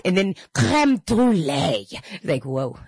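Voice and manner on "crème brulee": put-on sudden slow French accent. Chatty diner suddenly naming dessert, imitated French accent. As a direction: switch into a drawn-out mock French pronunciation. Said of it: "so how the accent changes".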